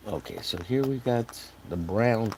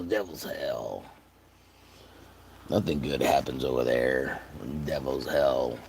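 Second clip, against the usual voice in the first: usual speaking voice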